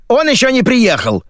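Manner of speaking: high-pitched, dutiful report; reporting briskly. A narrator's reading. Editor answers the radio, high brisk report. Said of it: "отрапортовала редактор".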